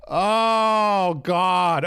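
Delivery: loud, high drawn-out "oh God"; exasperated groan. Real, not acted. Streamer fed up with his audio, groaning loudly. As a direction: groan it out in despair.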